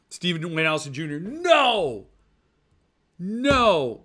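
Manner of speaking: a hammed-up firm refusal; mock refusal. Turning down Stephen Dwayne Allison Junior's trade, mock loud no.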